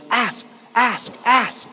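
high-pitched, chanted urging; kids urging him on. Retold or imitated. Kids in line urge Timothy, high imitated chant. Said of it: "all the kids in the line are going".

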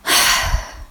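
quiet weary sigh out; a small deflated sigh; soft sigh. Retold or imitated. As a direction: sigh gently in defeat. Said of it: "And mine was".

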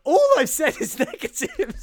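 high, loud laughing protest; laughing exasperation. Real, not acted. Host repeating his realisation to the co-host, laughing exasperation. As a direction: exclaim it helplessly through a laugh.

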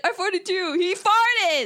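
high, loud mocking squeal; mock loud moviegoer. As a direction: ham up the rowdy guy in the theater. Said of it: "He's like".

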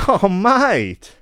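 high-pitched, exasperated Australian official; incredulous mock-Australian scolding, pitched high; Australian border officer. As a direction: do a hammed-up Aussie customs officer telling someone off. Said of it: "an Australian gentleman is like".

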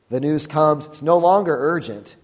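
ordinary talk, nothing marked

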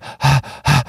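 exaggerated huffing breaths; mimed panting. Shown as a demonstration. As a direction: puff hard as if winded from paddling. Mimics gasping for air after paddling.

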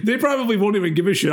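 ordinary talk

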